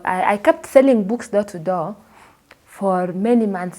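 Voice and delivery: ordinary talk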